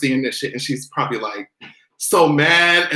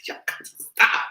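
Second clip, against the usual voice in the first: whispered laugh. Real, not acted. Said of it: "cry laughing".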